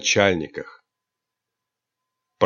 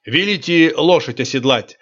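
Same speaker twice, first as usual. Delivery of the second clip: usual speaking voice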